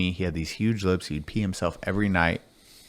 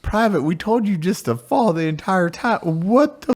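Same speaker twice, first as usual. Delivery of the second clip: higher, louder mock drill sergeant yell; 11 semitones higher, 4 dB louder than usual. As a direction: tease the private loudly. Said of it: "They're like".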